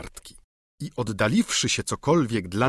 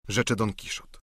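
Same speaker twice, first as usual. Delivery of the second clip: plain reading voice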